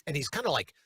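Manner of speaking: ordinary delivery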